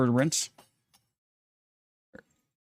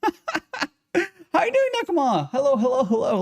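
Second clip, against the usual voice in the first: high squeaky greeting through a laugh; 12 semitones higher than usual. Real, not acted. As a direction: squeal hello to a viewer. Laughing greeting to new viewer.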